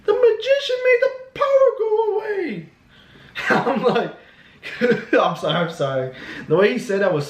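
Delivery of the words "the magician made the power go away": put-on very high squealing kid voice; comic falsetto kid tattling; imitating little kid. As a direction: mimic a child calling out to mommy. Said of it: "the kid was like".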